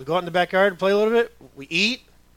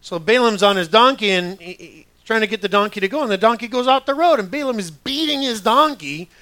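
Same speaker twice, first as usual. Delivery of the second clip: ordinary talk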